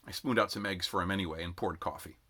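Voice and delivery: ordinary delivery, nothing marked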